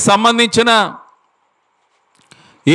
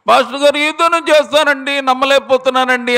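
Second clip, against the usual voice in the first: high, thin squeak of a woman who has lost her voice; squeaky voiceless woman; 6 semitones higher than usual. Retold or imitated. Woman with throat cancer begging the pastor, high strained squeak. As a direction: imitate a sick woman straining to speak. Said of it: "కీచు స్వరంతో అరుస్తూ ఉందట".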